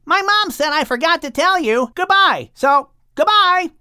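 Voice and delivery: very high, calling out; high child calling goodbye; young dolphin. A narrator's reading. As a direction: call back as the eager young dolphin. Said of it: "calling out".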